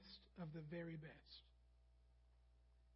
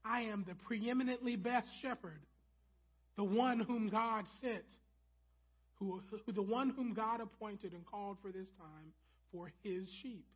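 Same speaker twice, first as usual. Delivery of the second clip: emphatic proclamation; 3 semitones higher, 6 dB louder than usual. Shown as a demonstration. Preacher voicing Jesus' claim to Pharisees, loud emphatic proclamation. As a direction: proclaim it as the best of the best. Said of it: "They heard".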